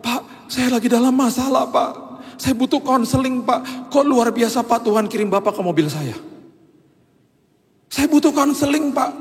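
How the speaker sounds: high, pleading mock-tearful driver; preacher imitating the tearful driver asking for counseling; imitated pleading driver. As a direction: beg in a pinched, high voice. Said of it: "Terus dia nangis".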